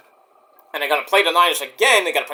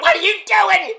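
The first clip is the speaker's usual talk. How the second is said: imitating Jason Jordan. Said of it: "Jason Jordan, like".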